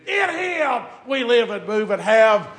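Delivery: high, loud, slow climbing sermon shout; preaching at full force. Acted. Preacher shouting hope to the congregation, full force. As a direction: build to a roaring preacher's cry.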